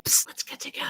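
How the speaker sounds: hushed, playful whispered invitation; conspiratorial whisper. Pretend. Coach voices a project beckoning its owner, conspiratorial whisper. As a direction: whisper it like a secret proposal.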